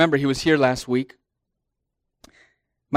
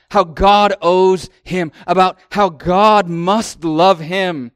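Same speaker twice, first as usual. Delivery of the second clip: mocking proud man; 3 semitones higher, 6 dB louder, slower than usual.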